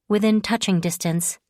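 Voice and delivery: plain reading voice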